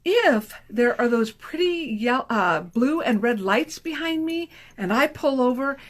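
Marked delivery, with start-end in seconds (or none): none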